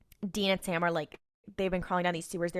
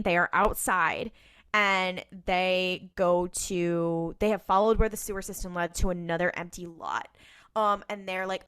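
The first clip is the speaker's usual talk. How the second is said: ordinary delivery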